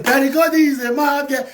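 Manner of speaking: loud scornful shout at a rival; shouted insult. Real, not acted. Ranting on her live video at a rival, shouting. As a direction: yell the insult out.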